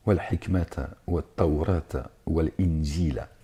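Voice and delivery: "wal injila" read slowly, word by word; slow Arabic recitation. Shown as a demonstration. Preacher slowly reciting Arabic scripture to his audience. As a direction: spell out "wa tawrata" with care. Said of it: "je suis pas arabophone".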